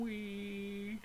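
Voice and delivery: thin high-pitched "wee" held out; imitated whine. Describing the noise in his ears, imitated whine. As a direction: mimic a piercing noise on one long high note. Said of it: "and you go, like".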